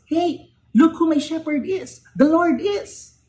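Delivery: David crowing about his shepherd, high proud boast. Retold or imitated. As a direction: show off your shepherd like a bragging kid. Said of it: "a sense of pride and boasting in David".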